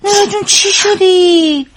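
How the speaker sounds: worried, stretched-out question; alarmed concern. Acted. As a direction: fuss over her in dismay. Receptionist alarmed at visitor's distress.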